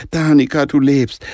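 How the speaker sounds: a mother gasping with relief; joyfully excited. A narrator's reading. Mother finding her lost daughter alive, excited. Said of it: "freudig erregt".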